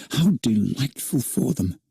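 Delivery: ordinary delivery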